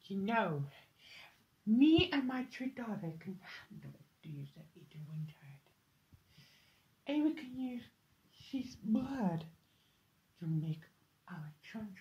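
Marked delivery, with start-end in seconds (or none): 3.2-11.9 much softer, whispering by the end; hushed scheming. Acted. Plotting with her daughters, hushed whisper. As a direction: murmur the sinister plan.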